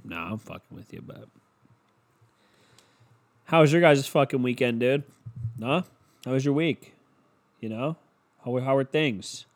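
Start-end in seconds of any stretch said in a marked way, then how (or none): none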